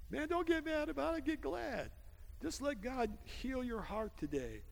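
high-pitched urging that softens; high exhortation. Real, not acted. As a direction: lift the voice high to urge them.

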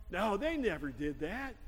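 ordinary talk

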